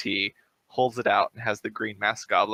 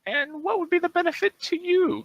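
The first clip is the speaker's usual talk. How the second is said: much higher, squeaky goblin question; goblin leader voice. Acted. Goblin leader questioning the party's fixer, high squeaky voice. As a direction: play the goblin chief, sly.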